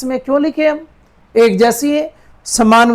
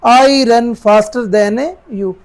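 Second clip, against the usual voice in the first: very slow full sentence; slow dictation; slow read; much slower than usual. Shown as a demonstration. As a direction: read the answer slowly. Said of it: "दौड़ता हूँ".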